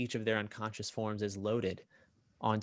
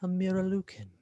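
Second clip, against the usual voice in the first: high, curious villain voice; intrigued villain; 9 semitones higher than usual. Acted. Villain peering at a rare captive, high intrigued voice. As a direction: lift it high with eerie interest. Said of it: "he wisps like smoke in the wind".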